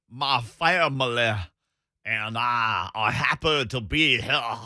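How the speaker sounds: slow, mock Southern drawl of a coach; lightly hammed-up Southern accent, slow; Foghorn Leghorn Southern drawl. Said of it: "he transformed into Foghorn Leghorn".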